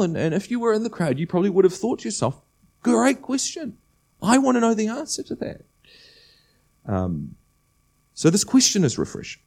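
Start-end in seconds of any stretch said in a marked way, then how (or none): 2.8-5.6 high-pitched, acted enthusiasm; imagined eager reaction. Preacher voicing what the crowd thought, eagerly. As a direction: voice an onlooker's keen thought, pitched right up.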